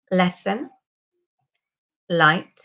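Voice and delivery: "Lesson" and "light" with a dark L, tongue on the ridge; back-resonant L. Shown as a demonstration. Teacher models words with L placed further back. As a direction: place the "l" just behind the step. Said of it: "now have a go at these words".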